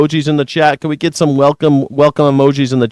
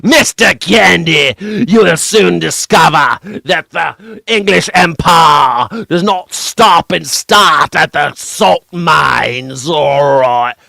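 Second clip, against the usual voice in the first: put-on slow, higher pompous English accent; 4 semitones higher, slower than usual. Streamer contrasting accents, slow mock English villain. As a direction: lord it over him in a slow English accent. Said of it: "the English accent".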